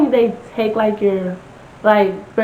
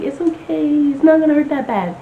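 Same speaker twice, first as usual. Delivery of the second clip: mock nurse reassurance; 6 semitones higher, faster than usual.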